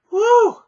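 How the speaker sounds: high loud relieved whew; exhausted whew. Real, not acted. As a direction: blow out hard from the heat. Complains room is hot.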